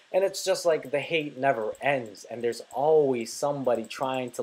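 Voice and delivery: normal talking voice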